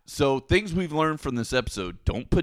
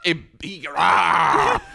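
ordinary delivery

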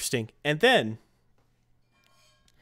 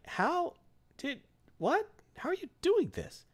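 high-pitched, halting bewilderment; baffled disbelief; 4 dB quieter, slower than usual. Retold or imitated. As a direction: imitate stammering astonishment, voice way up. Thor baffled as hammer freezes.

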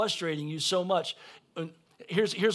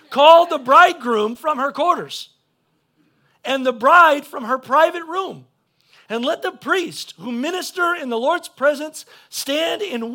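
loud, high preaching voice; full-force scripture reading; 11 semitones higher, 14 dB louder than usual. Acted. Preacher calling the whole congregation to gather, full-force scripture reading. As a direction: proclaim the verse in emphatic bursts.

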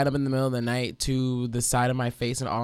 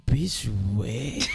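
mock half-asleep; 4 semitones lower than usual. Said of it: "he just goes".

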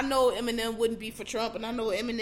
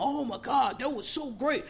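mock gushing fan; 3 semitones higher than usual. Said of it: "'cause he going".

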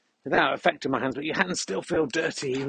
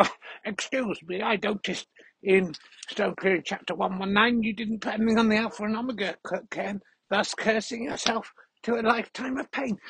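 high, prissy mock listener voice; high whiny nitpicker imitation; mocking nitpicky listener; 8 semitones higher than usual. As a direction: mimic a fussy pedant complaining. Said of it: "listener who s-, talks like that".

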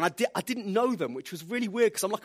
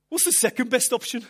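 higher Brummie-tinged punchline; quoted friend; 6 semitones higher, 5 dB louder than usual. Retold or imitated. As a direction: deliver the friend's line with a lift.

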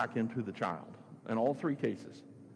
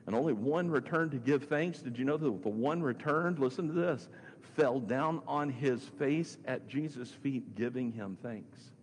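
ordinary talk

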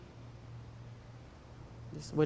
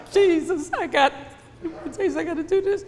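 a laughing mock panic, higher; 6 semitones higher than usual. Worried believer voiced to the congregation, mock panic. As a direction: play the flustered believer, half laughing.

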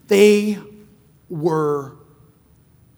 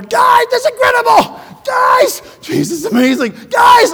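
much higher, loud ecstatic calling; excited shout; 11 dB louder, slower than usual. Retold or imitated. Peter on the water calling to friends, ecstatic shout. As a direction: shout to your friends in sheer wonder.

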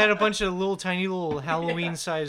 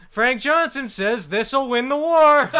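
infomercial; 5 semitones higher, 8 dB louder, slower than usual. Retold or imitated. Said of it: "infomercial guys".

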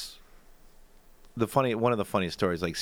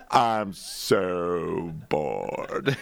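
very slow, stretched so bored; drawn-out bored; 4 semitones lower, much slower than usual. Retold or imitated. Mocking the driver's text with a long drawn-out moan. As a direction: stretch every O as long as you can.

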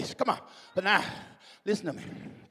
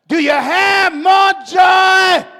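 slow, higher, louder call; Jesus pressing the disciples from the shore; 11 semitones higher, 11 dB louder, much slower than usual. Retold or imitated. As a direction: stretch the question out across the water. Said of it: "This how the dialogue goes".

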